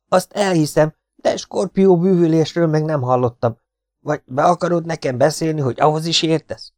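high, loud, agitated scepticism; frightened partisan, doubting. A narrator's reading.

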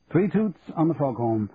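ordinary delivery, nothing marked

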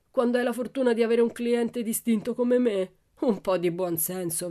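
Laban growling. A narrator's reading. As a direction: browbeat the old vendor, loudly. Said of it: "ringhiò Laban". (prepotente, ringhiando ad alta voce)

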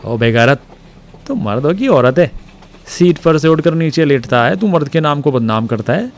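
a Pathan ridiculing a frightened clerk; mocking Pathan. A narrator's reading. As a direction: taunt him like a rough Pathan passenger. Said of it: "उसकी ठिठोली करने लगा".

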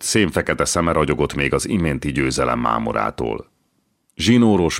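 usual speaking voice

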